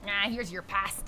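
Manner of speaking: lightly voiced school lunch lady, a touch put-on. Vlogger jokingly serves pasta while filling the pot, light lunch lady voice. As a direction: hand over the pasta like a lightly done cafeteria server. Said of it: "that's my lunch lady voice".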